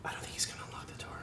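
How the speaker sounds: fast hushed whisper. Acted. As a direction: whisper so the kid can't hear. Parents whispering by the door.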